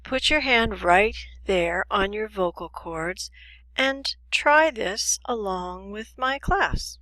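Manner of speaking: normal talking voice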